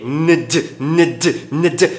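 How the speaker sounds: nd repeated as a consonant cluster. Shown as a demonstration. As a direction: drill the nd ending. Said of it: "Fica difícil de pronunciar".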